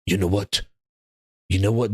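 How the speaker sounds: low, mumbly corporate exec voice; Herman Holtz impression. Streamer mocks Herman Holtz on a panel, low mumbly voice. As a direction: drop low and mumble like a cagey executive. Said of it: "Herman Holtz to sit down on a panel going".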